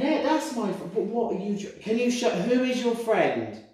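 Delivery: pressing the spirit for an answer; excited questioning. Real, not acted. Medium urgently asking the spirit who his friend is, high.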